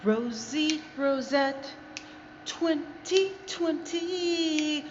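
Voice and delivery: slow, high, stretched-out sign-on; drawn-out announcer. Acted. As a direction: announce the name like a show host.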